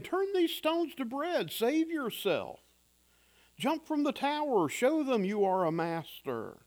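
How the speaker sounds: tempter's voice. Acted. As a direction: lure him, coaxing and sly. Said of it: "the voice of the tempter".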